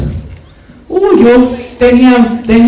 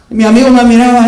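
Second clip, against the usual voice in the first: normal talking voice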